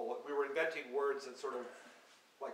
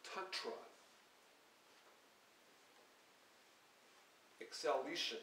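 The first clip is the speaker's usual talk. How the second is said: reading poem words; 2 semitones higher, 3 dB louder than usual. Acted. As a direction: let each word hang alone. Reads invented poem words aloud.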